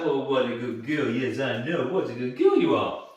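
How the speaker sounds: lightly acted happy dog praise; a touch of excited cooing. Shown as a demonstration. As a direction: gush over the dog, lilting. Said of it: "all happy and it's all excited".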